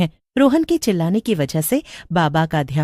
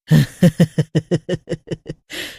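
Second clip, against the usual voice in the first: witch cackle. A narrator's reading. Witch cackling at her two captives. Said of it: "चुड़ैल कहती है".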